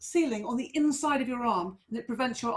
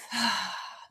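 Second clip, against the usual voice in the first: long breathy sigh out through the mouth; exhaling sigh; 2 semitones lower than usual. Shown as a demonstration. Teacher showing a class the out breath, audible sigh. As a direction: let the held breath go in a sigh. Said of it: "out through the mouth".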